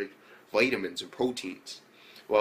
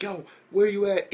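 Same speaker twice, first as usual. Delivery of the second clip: calling out; 10 semitones higher, 7 dB louder than usual. Retold or imitated. Character calls on radio.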